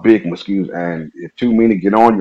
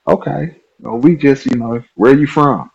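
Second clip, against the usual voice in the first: higher friendly-guy voice; Herman's voice; 8 semitones higher than usual. Retold or imitated. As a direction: pitch it up to play Herman. Herman chats, retold.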